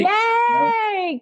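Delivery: high, delighted squeal; a little burst of joy at the host; excited cheer. Real, not acted. Guest cheering the host's eagerness, with a high excited squeal.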